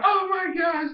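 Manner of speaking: very high mock shock; re-enacted startled reaction; alarmed. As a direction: squeak it out in dismay. Own alarm at the fall, re-enacted.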